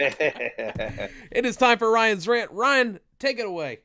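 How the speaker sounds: big, drawn-out segment announcement; high, slow, hammed-up introduction; announcer intro. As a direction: hand over like a show host. Host introduces the rant segment.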